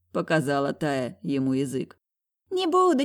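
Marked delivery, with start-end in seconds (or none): 2.5-3.0 put-on high insistent little boy; protesting child. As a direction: protest like a small kid. Child protests, jumping on bed.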